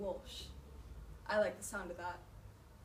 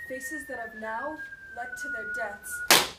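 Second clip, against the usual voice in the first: anguished cry; 12 dB louder, slower than usual. Acted. Officer anguished over dead men.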